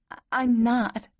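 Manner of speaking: high, halting choked denial. A narrator's reading. Woman pinned by her lover, choked. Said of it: "she choked".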